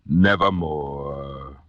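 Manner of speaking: very slow, drawn-out raven voice; raven's answer. A narrator's reading. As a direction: stretch it out like the raven's verdict. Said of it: "Quoth the raven".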